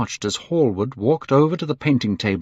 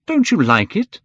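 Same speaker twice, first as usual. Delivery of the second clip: higher, louder anxious question; cried out; 10 semitones higher, 5 dB louder than usual. A narrator's reading. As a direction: cry it out as a question. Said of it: "cried Hallward".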